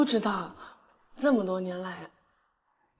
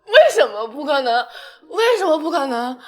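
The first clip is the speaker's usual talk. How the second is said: laughing through tears; 2 semitones higher, 11 dB louder than usual. Acted. Girl protests, laughing through tears.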